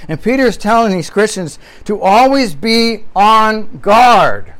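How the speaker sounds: always drawn out and hit hard; stress on always. Shown as a demonstration. Preacher to the church, stressing always. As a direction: lean on the word always. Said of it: "Now, emphasis, the word always".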